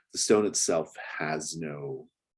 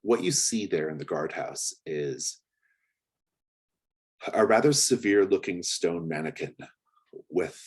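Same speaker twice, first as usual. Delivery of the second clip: ordinary talk, nothing marked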